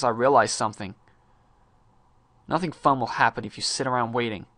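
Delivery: ordinary delivery, nothing marked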